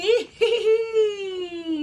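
high loud witch cackle. Acted. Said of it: "The witch cackled".

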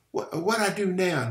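high-pitched defensive protest; belligerent. A narrator's reading. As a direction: challenge it, pitch jumping up. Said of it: "he demanded belligerently".

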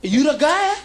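mock the eager fan in the bathroom; excited fan. Stranger recognizes the comic, voiced high and eager. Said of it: "he go".

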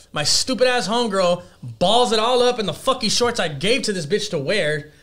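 normal talking voice

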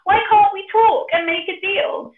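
high, loud squealing piggy plea; a pig pleading for its life, high and squealing. Acted. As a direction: beg the wolf as a frightened little pig. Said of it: "He cried".

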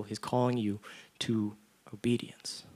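hushed emphatic whisper; soft intimate whisper for emphasis. Real, not acted. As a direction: lean in and breathe it. Preacher drops to hush for emphasis.

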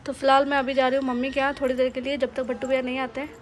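normal talking voice

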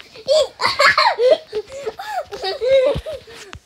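high, loud giggle fit; ticklish giggling. Real, not acted. Player reacting to the tickles, high giggling. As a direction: burst out giggling from being tickled. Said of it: "so, so tickly".

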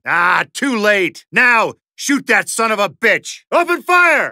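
rally the squad, full voice; ordering the team to attack, shouting; battle shout. Real, not acted.

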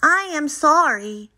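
high, loud Frog apology; gasping. A narrator's reading. Frog back from chasing the list, mildly gasping. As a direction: apologise in a high voice, lightly winded. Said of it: "gasped Frog".

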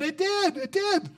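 put-on high, thrilled little girls' voice; excited girls. Pastor voicing the bakery girls, high and thrilled. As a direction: chirp it like delighted kids. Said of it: "And they said".

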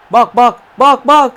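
panicking as the attack comes; rising frantic alarm. Real, not acted.

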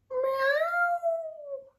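very high, drawn-out meow; cat's cry. A narrator's reading. Narrator voices Hetty at her cat door, crying meow. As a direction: wail it like a stuck cat. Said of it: "she cried".

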